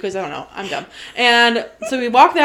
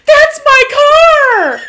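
much higher, louder, slow laughing shout; shocked shout; 9 dB louder, much slower than usual. Retold or imitated. Friend spotting her forgotten car, loud slow laughing shriek. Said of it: "you're like".

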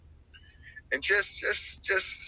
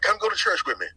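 mom pleading; 8 semitones higher, 4 dB louder than usual. Retold or imitated. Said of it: "She's like".

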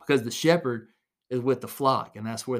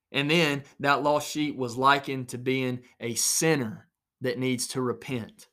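normal talking voice

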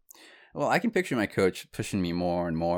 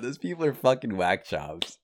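usual speaking voice

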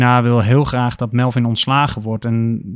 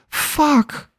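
panicked curse. Acted. Panic while checking her agenda.

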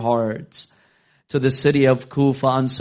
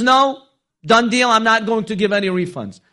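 arrogant refusal; 9 semitones higher, 4 dB louder than usual. Acted. Arrogant seller refuses the Imam.

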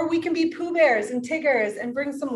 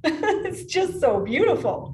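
laughing; 5 semitones higher, slower than usual. Real, not acted. Laughing joy after story.

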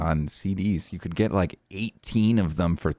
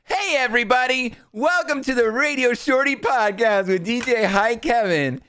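podcast intro. Acted. Host opening the podcast for listeners, announcer voice. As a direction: welcome listeners like a hyped host. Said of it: "Should we do the intro?".

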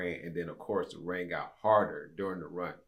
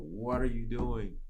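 slow, drawn-out question at usual pitch; imitated puzzled driver, unhurried; mild retold bafflement; slower than usual. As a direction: stretch the question out, mildly puzzled. Retells driver questioning him from car.

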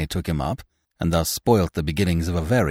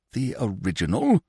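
"The original" higher, as a query; questioning; 6 semitones higher than usual. A narrator's reading. Binet the author queries André, "original" questioning. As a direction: lift "original" into a puzzled question. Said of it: "questioned Monsieur Binet".

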